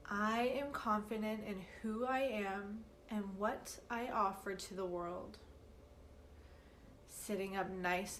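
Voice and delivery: slow, measured meditation guide with long pauses; unhurried, evenly paced calm guidance; guided meditation. Acted. Teacher leading listeners through a meditation for confidence, slow guided-meditation delivery.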